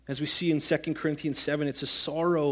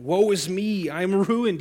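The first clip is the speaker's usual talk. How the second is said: raised, anguished woe; Isaiah crying woe before the Lord; 3 semitones higher, 4 dB louder than usual. Acted. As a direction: call it out in despair. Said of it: "he cries".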